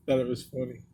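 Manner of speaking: ordinary talk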